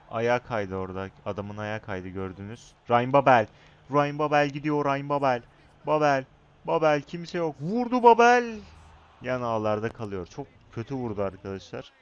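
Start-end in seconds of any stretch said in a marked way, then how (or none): 2.9-8.6 climbing to a high loud yell on the shot; cheering the striker on, louder and higher each name; building excited yell. Real, not acted. Gamer yelling at his striker, excited building to a scream. Live play as striker attacks.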